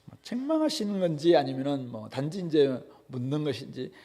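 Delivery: ordinary talk, nothing marked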